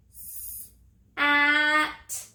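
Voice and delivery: "S-" then "at" said as two separate segmented sounds; stretched into sounds. Shown as a demonstration. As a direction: break the word into "S-" and "at". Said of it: "Let's stretch it".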